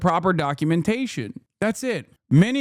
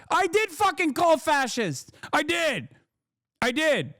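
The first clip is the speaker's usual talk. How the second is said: pitched way up, pressing each word hard; emphatic insistence; 10 semitones higher, slower than usual. Real, not acted. Streamer insisting to chat he called them fascist, emphatic and high. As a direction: own it, defiant and high.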